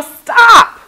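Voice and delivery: very loud, high warning shout; urgent yell. Shown as a demonstration. Warning a person crossing as a car comes, urgent yell. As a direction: yell to halt someone at once. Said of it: "you would yell".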